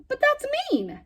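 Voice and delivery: high, loud outraged protest; a girl protesting the bird is treated meanly. Acted. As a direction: stand up for the poor bird. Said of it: "she protested".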